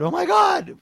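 high, loud shocked Oh my God; a horrified audience cry; loud audience gasp. Retold or imitated. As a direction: blurt it out shocked from the crowd. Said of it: "big, loud".

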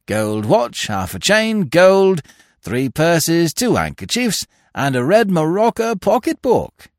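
loud, high-pitched inventory report; jailer calling out. A narrator's reading. Jailer reports pickpocket's loot to sergeant, calling out. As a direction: sing out each item to the desk.

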